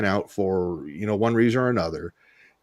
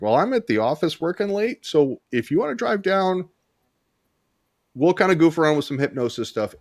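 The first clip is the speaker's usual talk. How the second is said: higher, light and easygoing, like a casual phone reply; breezy offer; 7 semitones higher than usual. Retold or imitated. Hypnotist inviting the frightened lady down, light and higher. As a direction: keep it casual and upbeat so she doesn't panic. Offering the scared caller a session.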